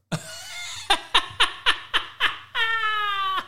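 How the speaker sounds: a big high cackling laugh at the heckler. Real, not acted. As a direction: burst out laughing in mockery.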